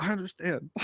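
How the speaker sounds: gently appease someone who is yelling; small, careful voice; retold placating.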